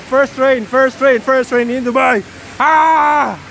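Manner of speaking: ordinary delivery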